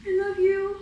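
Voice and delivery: high, tearful voice on the edge of crying; choked-up love for her dog. Real, not acted. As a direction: let the tears break into the words. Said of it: "Oh, and I'm already crying".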